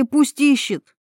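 high, urgent quoting back; blurted, demanding; insistent boy's outcry. A narrator's reading. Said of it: "выкрикнул он вдруг".

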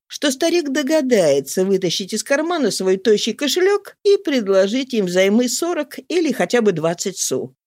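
usual speaking voice

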